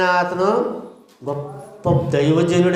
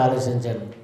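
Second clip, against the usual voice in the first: a soft, much quieter call to think it over; hushed appeal; 10 semitones lower, 8 dB quieter than usual. Acted. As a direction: drop to a near-hush on the last word. Preacher's hushed appeal to congregation.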